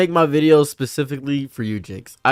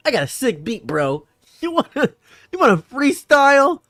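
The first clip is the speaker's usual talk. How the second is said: mock sitcom dude, pitch jumping high; boombox guy; 10 semitones higher than usual. Boombox guy offering a freestyle, hammed-up and high. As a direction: imitate a guy pitching his beat, climbing high. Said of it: "he has, like, a big boombox".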